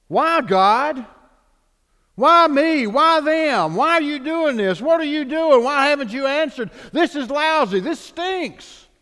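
high, loud mock protest; hammed-up angry whining at heaven; a believer loudly griping at God; complaining at God. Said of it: "we do the same thing".

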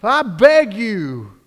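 high, loud pleading outcry; loud demonic cry. Acted. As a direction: cry out as demons begging before Jesus. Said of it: "They cried out in a loud voice".

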